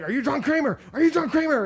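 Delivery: high, loud repeated demand; masked gunmen demanding the old man's name; frantic shouting. Retold or imitated. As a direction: bark the question at him twice. Kidnappers shouting the question.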